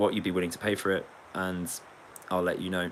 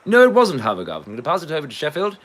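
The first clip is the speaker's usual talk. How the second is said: loud, high quizmaster rejecting an answer; mock quiz host; 8 semitones higher, 10 dB louder, faster than usual. Quiz host passing the question to Sheffield, mock-official. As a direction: reject it loudly and pass it across quickly.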